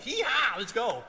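loud, high Southern whoop; imitated good-old-boy yell, high then easing off. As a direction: holler it like an excited Oklahoma cowboy. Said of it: "he had a real strong Southern accent".

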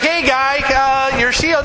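a hint of an officer flagging a soldier down; captain calling out. Captain calling the soldier back for his shield, lightly mock. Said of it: "the captain's like".

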